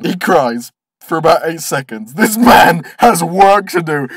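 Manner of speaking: normal talking voice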